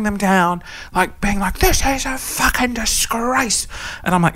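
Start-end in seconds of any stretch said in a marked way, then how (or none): 1.6-3.7 much higher, slow, furious; throwing a hammed-up fit; mock tantrum. Slamming things upstairs.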